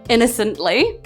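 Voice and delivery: ordinary talk, nothing marked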